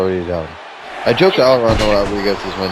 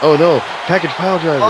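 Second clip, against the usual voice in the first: excited; 7 semitones higher than usual. Real, not acted. Calling a big move.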